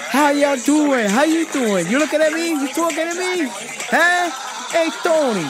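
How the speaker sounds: loud, high hammed-up New Jersey accent. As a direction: play a brash New Jersey guy. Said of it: "slip into a New Jersey accent".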